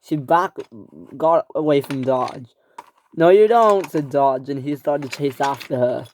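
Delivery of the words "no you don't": louder, higher villain taunt; loud gloating threat; menacing taunt. Acted. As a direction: chase her down with it. Dodge chasing Flora.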